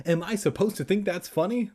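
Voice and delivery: high-pitched indignant question; mock angry reply. Reader parodies replies people send, mock indignant. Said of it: "angry replies I get".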